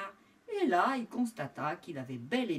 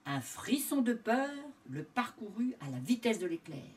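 usual speaking voice

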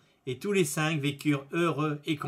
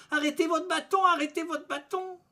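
high-pitched panicked cry on one held note; wailed high plea under a beating; 14 semitones higher, 5 dB louder than usual. A narrator's reading. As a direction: plead at the top of your range, louder. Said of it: "en criant".

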